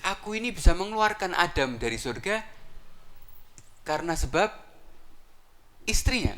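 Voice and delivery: high, gloating reveal; boasting iblis. Acted. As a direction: savour the reveal, rising high at the end. Iblis boasts of Adam's fall.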